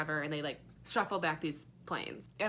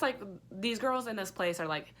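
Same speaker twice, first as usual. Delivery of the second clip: ordinary talk, nothing marked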